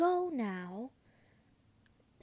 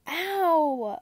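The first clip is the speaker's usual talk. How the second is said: loud high yelp of pain; whiny ow; 5 semitones higher, 8 dB louder than usual. A narrator's reading. Septimus yelping after falling in the hall. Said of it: "I whined".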